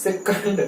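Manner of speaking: सेकंड as "se-kund", short uh in the second syllable; correct pronunciation. Shown as a demonstration. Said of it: "सेकंड नहीं".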